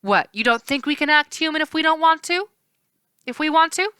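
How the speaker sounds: high, indignant scoff; mock him for the assumption; offended river spirit; scoffing naiad. A narrator's reading.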